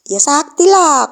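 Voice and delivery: slow, loud, smug brag; boastful. Retold or imitated. Dita bragging to her friends, slow smug boast. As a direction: drawl it out like a show-off kid. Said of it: "pokok sombong-sombong".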